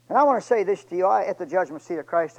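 loud, pleading appeal; fervent preaching. Acted. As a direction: call out to the whole church. Appeals to congregation.

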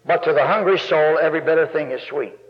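ordinary talk, nothing marked

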